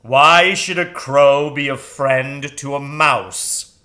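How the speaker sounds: loud, slow owl voice; grave owl questioning; booming, slow owl; sonorous owl. Acted. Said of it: "sonorous voice".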